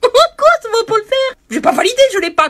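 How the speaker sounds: high, loud incredulous squawk, slowed; laughing indignation; outraged disbelief. Real, not acted. Outraged at scammer's threat.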